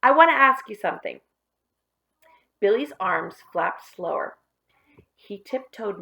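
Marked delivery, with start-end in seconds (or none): 0.0-1.2 higher, louder sudden call; sudden outburst. Acted. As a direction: blurt it out to stop him. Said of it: "burst out Tom suddenly".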